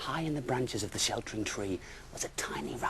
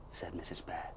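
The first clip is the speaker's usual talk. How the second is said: usual speaking voice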